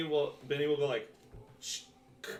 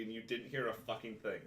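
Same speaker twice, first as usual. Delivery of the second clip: ordinary talk, nothing marked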